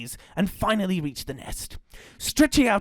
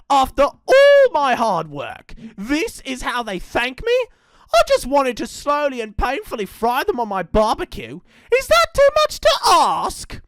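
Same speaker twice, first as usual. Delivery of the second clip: loud, higher whining rage, rising to a squeal; tantrum; 8 semitones higher, 7 dB louder than usual. Acted. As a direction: sulk and rage like a baby. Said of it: "throwing a tantrum like a little baby".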